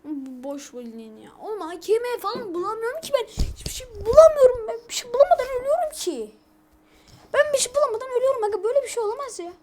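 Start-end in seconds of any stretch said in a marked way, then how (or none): 1.4-9.5 much higher and louder, fast at the peak; exasperated yelling. Real, not acted. Gamer yelling at friends he can't find any gun, exasperated fast rant. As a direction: rant furiously at the game.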